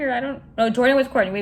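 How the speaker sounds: normal talking voice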